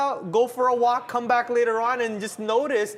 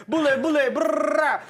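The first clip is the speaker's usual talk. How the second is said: loud, high "bullet, bullet" then a lip-trill brrr; excited gunfire noise; 7 semitones higher, 5 dB louder than usual. Real, not acted. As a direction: fire off the words like shots, loud and high. Celebrating market drop.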